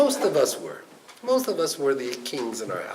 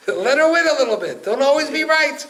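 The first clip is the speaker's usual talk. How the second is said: much higher and louder, driving the point home; emphatic advice; 12 semitones higher, 9 dB louder than usual. Acted. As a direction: deliver the punchline with force. Rabbi's punchline advice.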